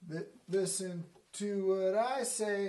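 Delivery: emphatic announcing voice; Thor. Acted. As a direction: command everyone's attention as a giant angel. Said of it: "gigantic angel comes the angel Thor".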